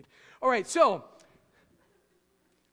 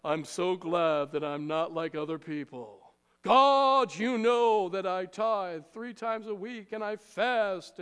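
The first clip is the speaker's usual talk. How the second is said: mock-holy Pharisee, pitched lower; pious Pharisee voice; 3 semitones lower, 4 dB louder than usual. Preacher mocking a Pharisee's showy prayer, lower and pious. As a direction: put on a self-righteous prayer voice, lower, with a burst on God. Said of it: "They're all about".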